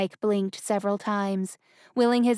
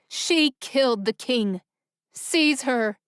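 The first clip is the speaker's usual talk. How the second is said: urgent command; 3 semitones higher, 3 dB louder, slower than usual. Acted. Prince commands guards.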